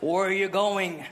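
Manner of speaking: low, slow official in English; stern American officer voice; American border officer. Acted. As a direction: question slowly and low like a customs officer. Border officer questions father.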